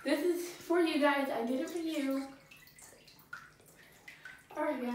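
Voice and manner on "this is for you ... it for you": normal talking voice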